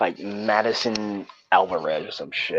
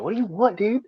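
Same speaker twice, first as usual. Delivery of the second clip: high, dismissive what do you want; lightly voiced irritated girl; mock annoyed girl; 12 semitones higher, 4 dB quieter than usual. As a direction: play her brushing him off. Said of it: "that was, like, her attitude".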